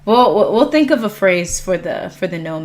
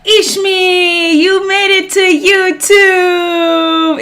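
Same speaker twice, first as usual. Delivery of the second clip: high, slow, drawn-out hail; excited greeting; 9 semitones higher, much slower than usual. Real, not acted. Streamer greeting Ishmi arriving on YouTube, excited. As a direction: sing out the welcome, stretching the words. Streamer hails viewer joining chat.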